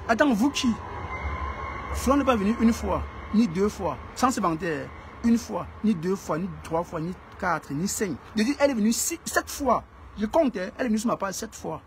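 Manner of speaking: indignant loud tally of the visits; heated outburst. Real, not acted. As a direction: hammer the count home, voice up. Heated rant about visit counts.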